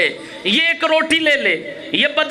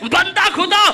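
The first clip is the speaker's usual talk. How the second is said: loud, higher, ringing shout; preaching climax; 5 semitones higher, 4 dB louder than usual. Acted. Preacher voices Maula to the congregation, shouting. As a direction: lift the hall with this line.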